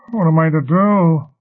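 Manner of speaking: loud, high, anguished moan. A narrator's reading. Ryle in pain asks for help, anguished moaning. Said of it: "Ryle moaned".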